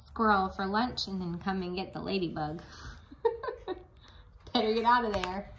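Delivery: ordinary delivery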